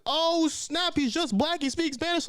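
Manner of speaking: high, excited chatter; mock excitement, voice way up; excited church members. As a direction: squeal it like thrilled gossipers.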